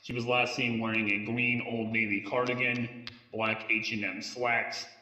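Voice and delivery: normal talking voice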